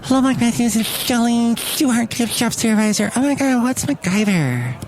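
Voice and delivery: high-pitched comic character voice; gift shop supervisor character. Acted. Supervisor Shelley greets the podcast host, high comic character voice.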